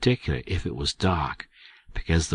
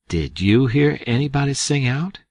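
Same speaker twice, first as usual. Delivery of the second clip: usual speaking voice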